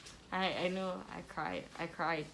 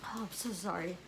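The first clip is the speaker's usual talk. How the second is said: tearful apology; 5 dB quieter than usual. Real, not acted. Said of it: "It's painful to even think about it".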